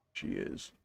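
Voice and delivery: whispered aside. Real, not acted. Quiet aside while setting screen.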